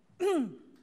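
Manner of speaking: loud hacking choke cough; real choking cough; loud coughing fit. Real, not acted. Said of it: "I actually am choking".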